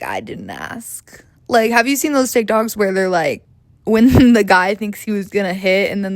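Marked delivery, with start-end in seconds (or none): 0.0-1.2 slow, low, whispered I didn't ask; mock whisper. Man reacting to her oversharing, in a mock whisper. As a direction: mutter it under your breath like a bored guy. Men's bored reaction, quoted.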